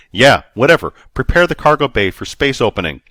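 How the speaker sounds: loud, irritated order; annoyed command. A narrator's reading. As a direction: snap it out impatiently.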